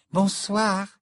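light, high, polite hello; polite greeting. A narrator's reading. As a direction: greet a teacher courteously. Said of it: "lui dit poliment Luna".

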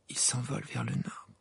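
soft, hushed realisation; murmur. A narrator's reading. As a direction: murmur it almost to yourself. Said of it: "murmura Hermione". (murmuré, tout bas)

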